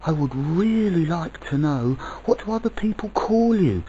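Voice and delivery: put-on high, insistent child voice; child crying out. A narrator's reading. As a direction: press the question like an eager child. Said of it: "cried the child".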